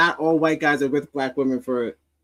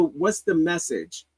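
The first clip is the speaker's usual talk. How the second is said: ordinary talk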